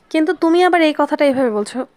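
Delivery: ordinary delivery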